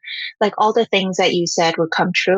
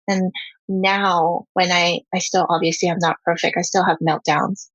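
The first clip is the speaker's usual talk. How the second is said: normal talking voice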